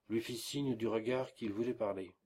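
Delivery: ordinary delivery, nothing marked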